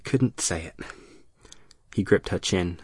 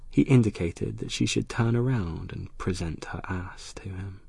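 plain reading voice

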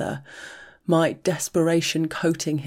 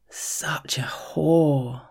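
slow savouring whisper; slow relishing whisper in her ear; much slower than usual. Acted. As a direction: savour each word, whispered. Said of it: "he says with relish".

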